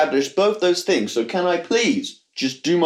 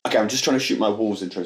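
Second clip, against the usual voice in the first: normal talking voice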